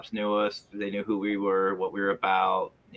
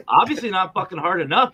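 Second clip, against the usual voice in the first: sarcastic jab; 8 semitones higher, 6 dB louder than usual. Retold or imitated. His own retort in retold story.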